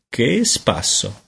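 ordinary delivery, nothing marked

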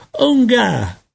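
put-on very high baby-talk cry; gridato. A narrator's reading. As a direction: squeal it like an infant. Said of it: "le gridò". (strillo acuto da neonato)

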